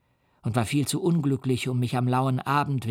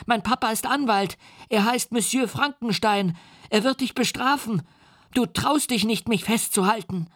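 put-on terrified child; 9 semitones higher than usual. A narrator's reading. The boy threatens the monster with his father, high and scared. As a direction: shriek each insult like a frightened child.

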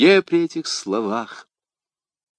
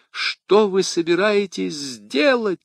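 higher, slow alarmed cry; 9 semitones higher, slower than usual. A narrator's reading. Madame Bonacieux cries out as d'Artagnan rushes to the door, alarmed. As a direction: cry out to stop him. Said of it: "вскричала госпожа Бонасье".

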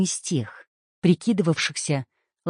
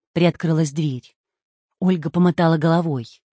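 ordinary delivery, nothing marked

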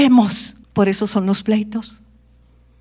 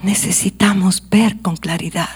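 louder, urgent pulpit preaching; fervent sermon voice; 4 dB louder than usual. Acted. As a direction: drive the message home to the congregation. Preacher presses the point louder.

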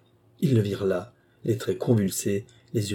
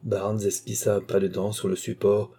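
plain reading voice

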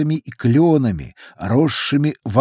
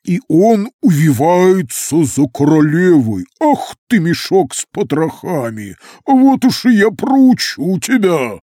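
furious watery voice, louder and higher; angry gurgling creature; 5 semitones higher, 5 dB louder than usual. A narrator's reading. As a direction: bellow threats like an angry fountain. Fountain creature threatens Barber.

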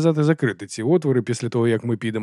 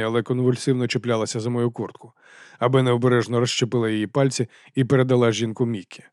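ordinary delivery

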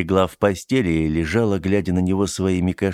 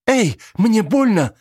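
pained yelp; 5 dB louder, slower than usual. Acted. Teddy yelps as he grips.

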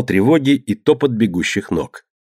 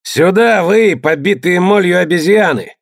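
shouting; 8 semitones higher than usual. A narrator's reading. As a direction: yell at the crew. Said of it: "крикнул Тьор".

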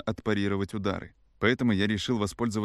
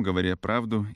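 usual speaking voice

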